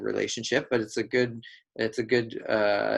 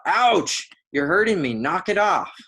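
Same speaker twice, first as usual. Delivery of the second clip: loud, high retold outburst; mock pained yell; 8 semitones higher, 6 dB louder than usual. Husband reacting to a shoulder rub, acted pained yell. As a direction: yell it out as if hurt. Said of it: "And he yells out".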